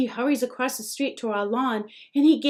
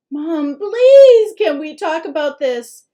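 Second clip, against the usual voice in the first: much louder, higher desperate plea; pleading cry; 6 semitones higher, 14 dB louder than usual. A narrator's reading. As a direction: beg mom to stop. Son pleading with angry mom.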